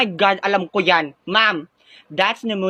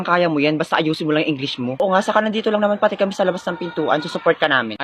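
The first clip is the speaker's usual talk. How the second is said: normal talking voice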